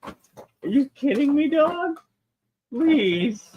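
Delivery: high, drawn-out whine of frustration; exasperated whine. Real, not acted. Streamer whining high after losing the toy. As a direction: groan at the bad luck. Lost toy under desk.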